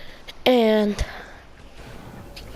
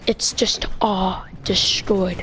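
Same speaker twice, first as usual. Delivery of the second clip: whispered, slow and hushed; awed whisper; 3 semitones lower, much slower than usual. Real, not acted. Narrator whispering about the destroyed greenhouse, hushed awe. Describing tornado wreckage in awe.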